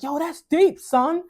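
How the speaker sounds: high, loud New York street reaction; New York slang. Retold or imitated. Student reacting to a pastor, in loud New York slang. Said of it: "my little slang".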